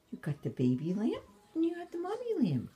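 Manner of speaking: high, fast, excited pet voice; talking to a dog. Real, not acted. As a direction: gush over the toys to the dog.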